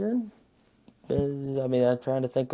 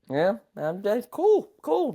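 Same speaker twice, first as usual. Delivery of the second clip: high, sarcastic sing-song cool; 9 semitones higher, slower than usual. Real, not acted. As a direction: brush it off with fake cheer. Sarcastic dismissal of rival's pick.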